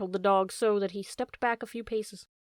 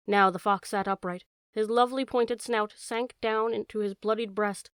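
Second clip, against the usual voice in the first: ordinary delivery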